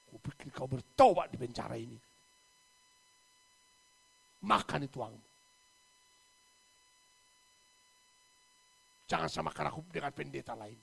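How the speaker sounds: firm loud rebuke; loud scolding. Retold or imitated. Pastor rebuking the proud businessman loudly. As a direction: scold him hard.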